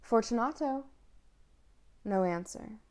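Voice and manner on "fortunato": a first call into the dark; calling into the niche. Acted. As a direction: call his name into the wall. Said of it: "I called aloud".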